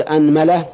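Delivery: "anmala", every vowel a short a; fatha on both. Shown as a demonstration. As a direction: say أنملة as "anmala", a after the hamza and the m. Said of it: "هذا في الفتح". (أنملة بفتح الهمزة وفتح الميم)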